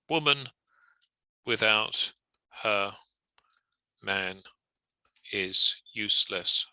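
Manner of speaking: flat robotic reading, each word spaced out by long pauses; slow, evenly paced robotic delivery with no inflection; robotic, no emphasis. Shown as a demonstration. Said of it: "as robotically as possible".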